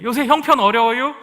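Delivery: loud, high roundabout Chungcheong question; 충청도 화법으로 능청스럽게; Chungcheong indirect talk. Retold or imitated. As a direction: ask it sideways in Chungcheong style. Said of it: "이렇게 얘기하는 게 충청도 화법입니다".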